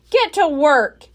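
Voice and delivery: loud pirate command; Captain Bones ordering the two pirates. A narrator's reading. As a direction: boss the crew around. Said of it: "Captain Bones said to Pinky and Stinky".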